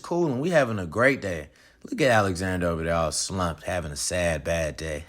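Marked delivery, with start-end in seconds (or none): 1.9-5.0 much lower, fast teasing voice; mocking bully. Brothers teasing Alexander, deep mocking voice. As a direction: sneer at him in a deep mocking voice.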